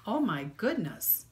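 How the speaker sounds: ordinary delivery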